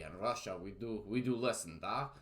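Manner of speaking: a deliberately hammed-up Russian accent; mock Russian teacher voice, jokey and broad; lightly put-on Russian accent; bad Russian accent. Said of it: "what I'm sure will be a bad Russian accent".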